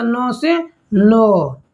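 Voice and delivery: नौ से नौ stretched very slowly, word by word; slow drill. Shown as a demonstration. Teacher slowly spelling out the Tamil Nadu trick. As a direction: drag out the mnemonic at a crawl. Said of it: "तो ना से याद रखिएगा".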